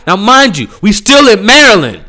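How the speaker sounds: ordinary talk, nothing marked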